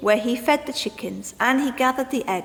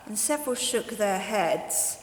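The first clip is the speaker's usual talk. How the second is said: usual speaking voice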